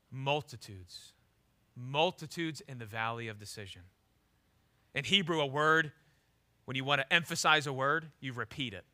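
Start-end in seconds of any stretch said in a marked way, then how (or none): 0.1-3.8 solemn hush. Acted. Solemn quoted verse.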